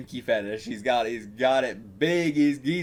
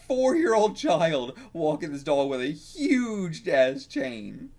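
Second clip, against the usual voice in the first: ordinary talk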